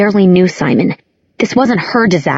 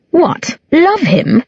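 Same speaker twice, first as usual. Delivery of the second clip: higher mock disbelief; scoffing; 9 semitones higher, much slower than usual. A narrator's reading. Alec scoffs at his sister, higher. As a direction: scoff at the idea. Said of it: "Alec scoffed".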